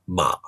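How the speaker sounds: MA kept low, no rise; low third tone. Shown as a demonstration. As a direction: say "ma" short and low. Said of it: "usually just a low tone".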